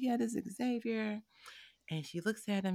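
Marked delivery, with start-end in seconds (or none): 0.0-1.2 high, slow proud reply; proud young mom. Retold or imitated. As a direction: introduce your little son with a lifted voice. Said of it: "I'm like".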